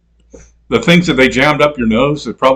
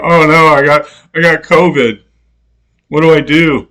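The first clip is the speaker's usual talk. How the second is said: raised, louder mock alarm; mock panic; 4 semitones higher, 4 dB louder than usual. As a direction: imitate a worried patient, hammed-up. Mocks frightened covid patient.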